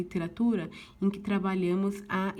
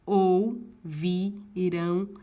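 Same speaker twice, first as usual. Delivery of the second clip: syllables drawn out slowly; 5 dB louder, much slower than usual. Shown as a demonstration. Teacher to class, scanning the verse slowly. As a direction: stretch ouvirão syllable by syllable, very slow. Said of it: "fazendo a escansão".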